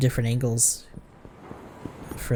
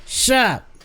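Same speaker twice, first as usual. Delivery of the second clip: higher, louder slurred challenge; belligerent drunk; 5 semitones higher, 4 dB louder than usual. Acted. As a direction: cut them off like a cranky drunk. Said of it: "especially seeing how drunk you are".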